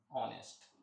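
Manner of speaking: ऑनेस्ट, open vowel, no h; correct pronunciation. Shown as a demonstration. Teacher modelling the word for students, ऑनेस्ट without h. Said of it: "इसको पढ़ते हैं ऑनेस्ट".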